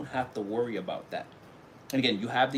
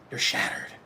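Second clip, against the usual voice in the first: a hammed-up whispered lament; mock whisper. Reader mocking the rejected coworker, whispered. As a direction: breathe it like tragic gossip.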